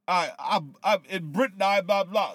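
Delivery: half-hearted British tough-guy impression; mock British goon talk. Imitating the British wrestler in a mock British accent. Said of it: "British goon talk".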